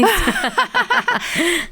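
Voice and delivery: a real, high-pitched burst of laughter. Real, not acted. Host laughing at the guest's remark about years, real high laugh. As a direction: let a high giggle escape.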